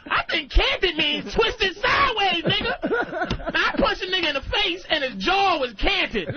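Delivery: high-pitched mock outrage, words stretched out; hammed-up indignant rant. Passenger who thinks he knows what canted means, hammed-up high rant. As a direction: rant in rising, incredulous disbelief.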